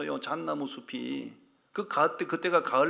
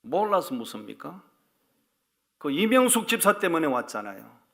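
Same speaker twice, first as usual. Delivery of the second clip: louder, rising indignant complaint; 3 semitones higher, 4 dB louder than usual. Retold or imitated. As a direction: protest to God with a raised voice. Complains to God in prayer.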